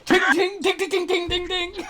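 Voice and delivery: high bright ting sound effects; high tinging noises. Retold or imitated. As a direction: chirp the bouncing bearings up high. Imitates ball bearings bouncing.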